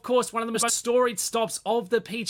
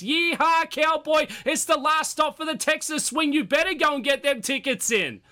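hammed-up cowboy hype; fake cowboy accent; 6 semitones higher than usual. Hyping the Texas golf stop.

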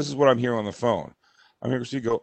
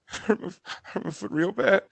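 high, small mock whimper; soft put-on tearful mumble; imitated fake cry; 6 semitones higher, 5 dB quieter than usual. Husband imitates wife crying to bystanders, mock whimper. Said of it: "here is how fake her cry is".

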